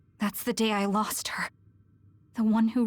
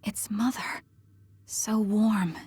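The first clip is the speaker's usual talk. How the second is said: hushed recognising whisper; slower than usual. Acted. Recognising mother in memory.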